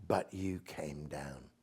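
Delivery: But you came down, slow, soft and deliberate; short line set apart. Shown as a demonstration. Poet to host, showing the short line: slow quiet emphasis. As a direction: land the short line with weight. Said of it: "Just four words".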